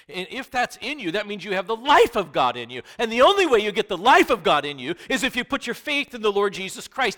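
high, loud preaching climax; full-force preaching. Acted. Pastor urging faith in Jesus, full-force preaching. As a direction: drive the gospel call home.

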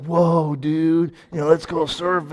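a hint of a laid-back California surfer accent; lightly voiced California surf dude; mild imitation of a California surfer. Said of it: "And out in California, it's like".

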